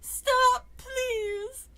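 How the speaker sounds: high, loud sobbing plea; crying plea. Acted. Frightened girl begging the dummy, high and crying. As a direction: beg through tears to stop. Scared girl begs the puppet.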